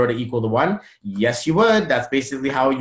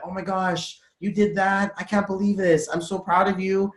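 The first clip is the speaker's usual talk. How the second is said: proud, amazed praise; 6 semitones higher than usual. Shown as a demonstration. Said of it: "different intention".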